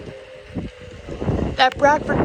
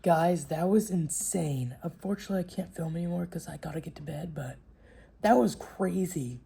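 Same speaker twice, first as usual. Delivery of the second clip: quiet sign-off; 6 semitones lower, 12 dB quieter than usual. Real, not acted. Signing off late at night.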